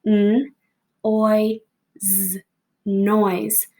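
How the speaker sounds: noise segmented into N, oi, z; sounding out. Shown as a demonstration. Teacher counting the sounds in noise. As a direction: say each sound apart, then blend into noise. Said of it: "Count the sounds, get ready, go".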